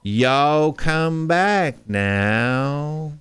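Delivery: very slow, stretched Southern Savannah accent; slow Southern Georgia drawl; drawn-out Savannah drawl. Shown as a demonstration. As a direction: drag every vowel out like a Savannah belle. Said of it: "real drawn out".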